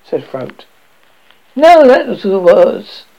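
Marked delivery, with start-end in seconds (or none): 1.5-3.0 high, loud, addled voice; dazed alchemist. A narrator's reading. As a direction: play a half-concussed man.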